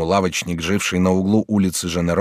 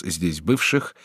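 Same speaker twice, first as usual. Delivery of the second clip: ordinary delivery, nothing marked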